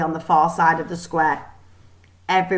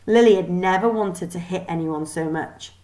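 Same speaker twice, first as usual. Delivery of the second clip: ordinary delivery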